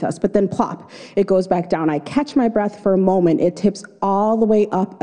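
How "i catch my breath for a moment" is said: ordinary talk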